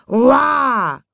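high, loud "uah" roar; lion's roar. A narrator's reading. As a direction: roar out as the storybook lion. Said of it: "schrie der Löwe". (lauter, hoher Löwenschrei)

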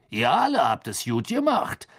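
booming, cheerful official; jovial Bagman. Acted. Bagman greets the champions in the tent, jovial and loud. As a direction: burst in full of good cheer.

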